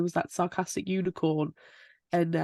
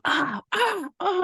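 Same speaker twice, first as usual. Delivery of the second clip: imitated ah-ing; 12 semitones higher, slower than usual. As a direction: go "ah, ah" high and irritating. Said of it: "I feel like Alan Dune's going".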